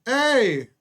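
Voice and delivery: loud, high greeting shout; calling out. Retold or imitated. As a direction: hail an old acquaintance across the room. Calling out to recognized guy.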